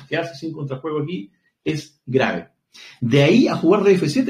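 ordinary talk

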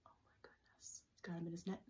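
whispered excitement; hushed aside. Acted. Teacher whispering to children about the catch, hushed. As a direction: breathe it out to the kids like a secret. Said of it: "aside to children while reading".

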